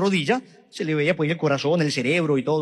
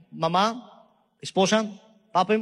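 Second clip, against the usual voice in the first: ordinary talk, nothing marked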